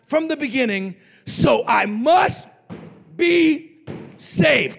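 loud, slow, hammered-out sermon; full-force preaching. Real, not acted. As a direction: punch each phrase out with long pauses.

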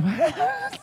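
high-pitched rat squeal; imitated rat noise. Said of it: "they make these noises".

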